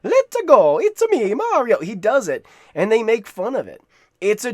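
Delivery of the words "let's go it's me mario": high-pitched, loud cartoon Italian catchphrase voice; exaggerated squeaky Italian hero impression; Mario. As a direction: imitate a bouncy, high game-hero shout. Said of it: "the Mario voice".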